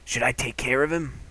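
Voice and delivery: ordinary delivery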